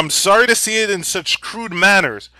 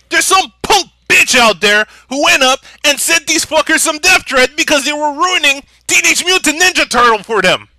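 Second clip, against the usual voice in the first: ordinary talk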